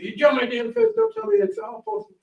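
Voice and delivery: angry farmer, lightly voiced; mock furious yell. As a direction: retell his rant as a mild mock yell. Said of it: "starts screaming at me like a Tasmanian devil".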